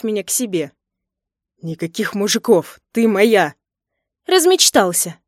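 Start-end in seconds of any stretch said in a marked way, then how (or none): 1.6-3.5 loud, forceful possessive order; possessive anger. A narrator's reading. Husband forbidding other men, possessive and angry. Jealous husband claims his wife. 4.3-5.2 high, loud scoffing retort; scornful snort. A narrator's reading. Wife rejecting husband's claim, scornful snort. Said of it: "фыркнула я".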